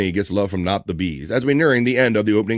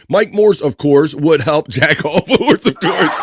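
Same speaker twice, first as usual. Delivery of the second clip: laughing; 5 semitones higher, 4 dB louder, slower than usual. Real, not acted. Laughing reading a crude rhyme.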